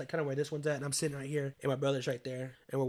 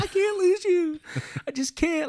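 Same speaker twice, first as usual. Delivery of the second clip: very high, loud mock-tearful plea; retold crying plea; 5 dB louder than usual. Grandson cries he can't lose grandma, high loud imitation of crying. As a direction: act out breaking into tears, pitch way up. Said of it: "Then I start crying right there".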